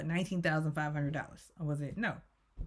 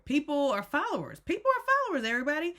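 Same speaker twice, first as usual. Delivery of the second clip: high-pitched imitation, climbing higher on the repeat; mock proclamation; 8 semitones higher, faster than usual. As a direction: address the whole group in a high put-on voice. Acted speech scolding the group.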